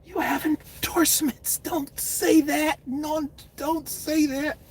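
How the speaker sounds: a hint of a nervous manager; quietly begging don't say that; pleading handler.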